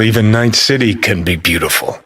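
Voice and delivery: usual speaking voice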